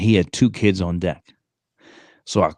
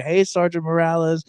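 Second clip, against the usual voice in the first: put-on kids greeting; 8 semitones higher than usual.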